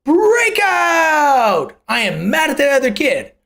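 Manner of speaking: high, loud mock outcry, easing down; hammed-up indignant parent; acted snap conclusion. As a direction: blurt it out in exaggerated high-pitched outrage. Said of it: "you might assume".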